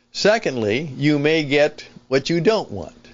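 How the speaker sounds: ordinary talk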